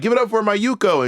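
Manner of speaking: high, imitated host's intro; show host introduction. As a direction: call the performer up to the stage. Voicing the show's announcer.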